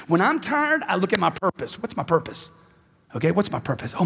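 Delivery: usual speaking voice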